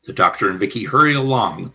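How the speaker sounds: ordinary talk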